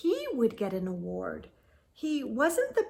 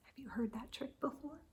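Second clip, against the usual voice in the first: whispered aside, fast; quick hushed aside to the listeners; 2 semitones higher, 18 dB quieter, faster than usual. Real, not acted.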